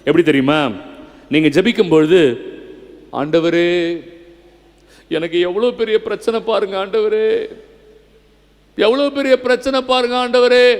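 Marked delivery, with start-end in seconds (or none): 3.1-10.7 wailing prayer, hammed up; mock pleading prayer. Shown as a demonstration. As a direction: imitate someone moaning a problem to God. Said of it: "அப்படின்னு ஜெபிக்காத".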